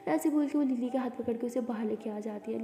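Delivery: ordinary delivery, nothing marked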